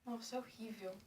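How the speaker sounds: whispered disgust. Real, not acted. Disgusted at the taste.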